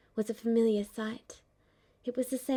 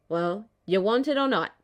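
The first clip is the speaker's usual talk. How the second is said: put-on louder, impatient old man; gruff old shopkeeper; 7 dB louder than usual. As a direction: grunt it out impatiently.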